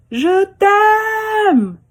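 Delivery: high, loud, tender call; loving child. Acted. Girl calling out her love to the mountains. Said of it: "elle finit par dire".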